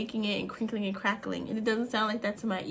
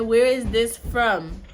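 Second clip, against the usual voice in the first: loud, slow, higher-pitched question; insistent repeat; 4 semitones higher, 9 dB louder, much slower than usual. Real, not acted. Mother repeating question to son, insistently loud. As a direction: space the words out loudly to be heard.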